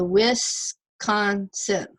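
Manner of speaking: "Wisconsin" in three separate chunks; slow teacherly breakdown of "Wisconsin"; syllable by syllable. Shown as a demonstration. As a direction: sound out "Wisconsin", pausing after each syllable. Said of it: "Consonant, vowel, consonant".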